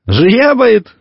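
high, amused echo of a funny word; lightly acted delighted question; amused boy. A narrator's reading. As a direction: repeat it as a tickled boy, pitched high. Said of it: "засмеялся Иван".

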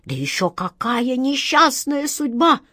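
high, slow, dramatic seer voice; eager fortune-teller. A narrator's reading. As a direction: seize on his fear. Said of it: "подхватила гадалка". (гадалка подхватывает и пугает капитана Жана)

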